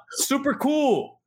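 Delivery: slow, high "Super cool" called out; drawled super cool. Retold or imitated. Shouting to Michael Beasley on the corner, slow and high. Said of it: "I yelled out".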